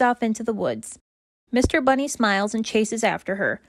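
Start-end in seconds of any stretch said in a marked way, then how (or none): none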